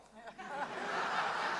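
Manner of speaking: high breathy burst of laughter; delighted laugh. Real, not acted. Pastor laughing at the convert's sudden vision, breathy laugh.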